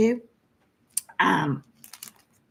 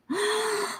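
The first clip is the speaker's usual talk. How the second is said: 1 surprised gasp; 6 dB quieter than usual. Real, not acted. Finds unexpected chart.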